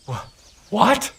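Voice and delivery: high, sharp rising disbelief; shocked what. Acted. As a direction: caught off guard, blurt it out.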